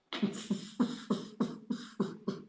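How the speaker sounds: breathless, voiceless laugh; silent wheezing laugh. Real, not acted. Picturing the robot monkey at the door, wheezing laugh. Amused by his own story.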